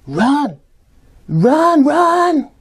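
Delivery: rising high yells; excited cheering. Acted. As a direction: cheer the runner on. Cheering at the game.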